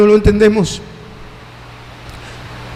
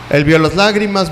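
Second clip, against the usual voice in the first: normal talking voice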